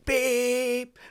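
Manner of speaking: loud, high held beep; car horn beep. Retold or imitated.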